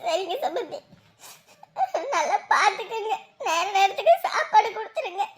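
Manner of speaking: high, sobbing plea; tearful crying. Acted. Mother asking in-law to feed her son, crying. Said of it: "விடுங்க, விடுங்க, அழாதீங்க".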